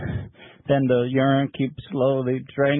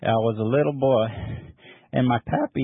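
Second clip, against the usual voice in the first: usual speaking voice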